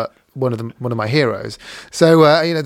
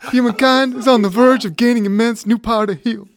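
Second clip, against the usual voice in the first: higher hammed-up American accent, breaking into laughter; laughing through a mock American presidential quote; 8 semitones higher, 4 dB louder than usual. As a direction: keep the mock American voice going while cracking up. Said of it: "I could do mine in an American accent".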